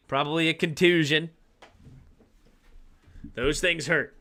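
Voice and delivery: ordinary delivery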